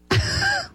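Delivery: very high squeal; hammed-up wordless screech; mock shriek. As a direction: squeal like a hysterical objector. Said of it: "That's what they sound like".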